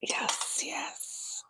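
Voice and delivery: soft quick whisper. Real, not acted. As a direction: whisper it under your breath. Whispering aside while eating.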